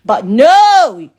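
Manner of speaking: a loud, abrupt "no!" with the pitch arching; sharp outburst. Real, not acted. Ranting at the ex about the fight, loud scornful outburst. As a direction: bark it out in sarcasm.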